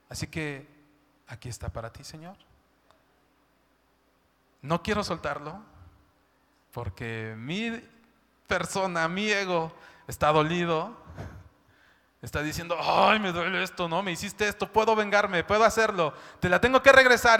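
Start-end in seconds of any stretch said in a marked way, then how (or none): 0.1-2.4 softer, falling to a hush; soft prayer. Pretend. Quiet prayer acted out. 12.8-17.4 building from hurt to a louder grudge; wounded soul crying out. Acted. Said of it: "Eso es lo que grita nuestra alma".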